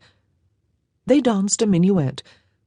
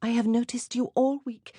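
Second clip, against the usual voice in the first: put-on lightly higher girlish voice for Jeanne; young French girl, a little higher; 7 semitones higher, 9 dB quieter than usual. A narrator's reading. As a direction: give her a soft, light girl's voice. Jeanne's voice, softer.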